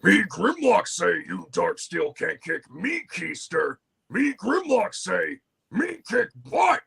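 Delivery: slow, high robot dinosaur voice; Grimlock voice. Acted. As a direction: caveman robot talk, slow and broken. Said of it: "the line exactly, it was this".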